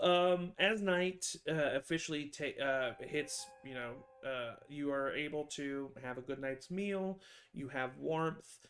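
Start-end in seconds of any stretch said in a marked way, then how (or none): none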